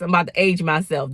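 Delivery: ordinary talk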